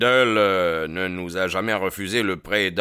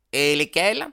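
voice from the room; 9 semitones higher, 6 dB louder than usual. A narrator's reading. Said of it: "demanda quelqu'un".

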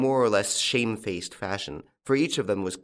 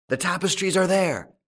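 high, raised exclamation; crying out; 9 semitones higher than usual. A narrator's reading. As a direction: call it out sharply to the whole room. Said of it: "cried someone".

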